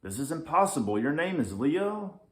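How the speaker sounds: can't believe it; stunned. Acted. Lynx stunned.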